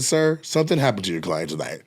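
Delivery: low, subdued, formal address; understated stern report to security; mock-serious complaint. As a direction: drop low and soft, gravely official. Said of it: "I said".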